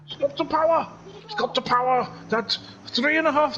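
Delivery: high, excited Welsh commentary; Welsh accent. Pretend. Commentator following Phil's overtake, excited Welsh accent. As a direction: call the overtake in lilting Welsh. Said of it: "this is an accent I've been doing".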